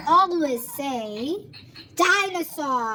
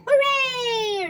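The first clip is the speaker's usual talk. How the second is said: cheer; 8 semitones higher than usual. Acted. Cheering at the noise.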